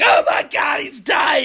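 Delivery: high, loud movie-style freak-out; Michael Keaton. Retold or imitated. Film actor panicking at a death, high shouted parody. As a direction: yell it as an over-the-top impression. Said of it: "It's like Michael Keaton".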